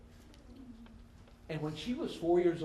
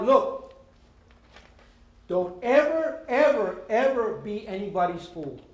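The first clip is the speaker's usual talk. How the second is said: louder, higher, hammering each ever; firm warning; 3 semitones higher, 5 dB louder than usual. Retold or imitated. Father warning his daughter, loud and insistent. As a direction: drive the warning home.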